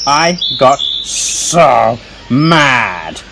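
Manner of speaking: low, very slow, drawn-out exasperation; hammed-up slow fuming; mock anger. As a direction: stretch it out in playful storybook anger. Squirrel acts out his anger.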